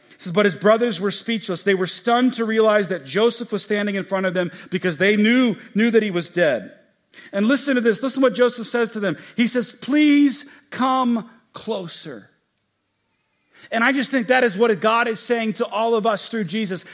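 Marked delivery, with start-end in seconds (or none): 0.1-9.7 loud, higher preacher's voice; impassioned sermon delivery; preaching at full force. Acted. 9.7-12.3 very slow, drawn-out invitation; drawn-out pleading call; tender plea. Acted. Joseph pleading to brothers. 13.7-15.1 loud, higher preacher's voice; impassioned sermon delivery; preaching at full force. Acted.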